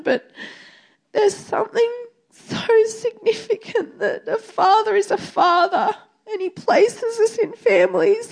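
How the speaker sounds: normal talking voice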